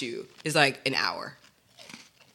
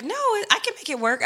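oblivious mom. Retold or imitated. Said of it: "Mom was like".

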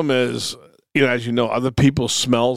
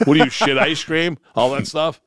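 normal talking voice

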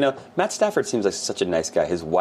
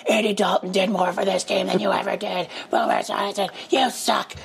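high, mocking fan rant; mocking angry fans; 13 semitones higher than usual. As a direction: mimic jeering Cincinnati fans in a much higher voice. Imitating angry Cincinnati fans.

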